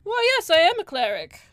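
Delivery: high, loud, perked-up elf; perky Mince. Acted. Elf cleric answering a question about being a cleric, perky and high. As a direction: perk up brightly as the elf cleric. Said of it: "perks up and says".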